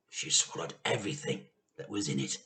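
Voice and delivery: ordinary delivery, nothing marked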